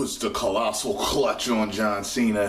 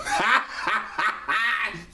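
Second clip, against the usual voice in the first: giddy high cackle of joy; high excited laugh; 12 semitones higher than usual. Real, not acted. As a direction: burst of high delighted laughter. Excited over Sid's return.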